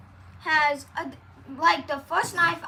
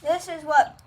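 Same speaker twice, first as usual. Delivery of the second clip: talking through a mouthful; words muffled by peanut butter; mouth full of peanut butter; slower than usual. Real, not acted. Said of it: "I have peanut butter in my mouth".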